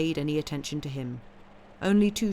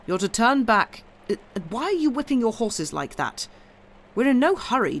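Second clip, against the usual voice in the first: calling out; 7 semitones higher, 6 dB louder than usual. A narrator's reading. Calling to the coachman ahead.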